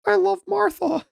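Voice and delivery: squeaky falsetto mimic; whiny high mock voice. Repeating the mock voice.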